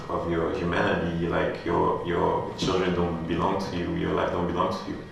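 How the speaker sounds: usual speaking voice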